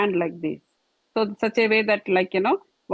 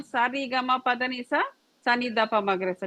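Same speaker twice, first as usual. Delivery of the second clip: spoken sargam; faster than usual. Shown as a demonstration. Teacher recites the scale syllables quickly before singing. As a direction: rattle off "Sa re ga ma pa da ni sa" plainly, then the descent fast. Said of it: "So tell".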